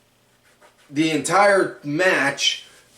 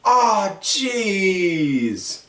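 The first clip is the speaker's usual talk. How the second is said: dismayed Woods; 5 semitones higher, slower than usual. Retold or imitated. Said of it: "Woods is looking like".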